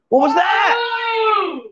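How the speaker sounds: high, slow, drawn-out shout; startled shout. Real, not acted. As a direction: stretch it out in mock alarm. Another shriek from the table.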